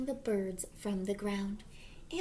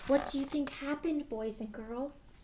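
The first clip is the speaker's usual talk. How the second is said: usual speaking voice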